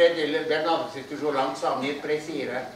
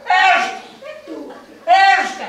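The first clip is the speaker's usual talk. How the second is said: much higher, louder call; 11 semitones higher, 5 dB louder than usual. Acted. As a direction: shout his name down the crevasse. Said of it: "Puis il dit".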